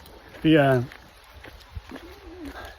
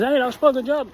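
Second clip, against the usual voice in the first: cheering runners; 9 semitones higher than usual. Retold or imitated. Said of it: "Deux gars qui disent".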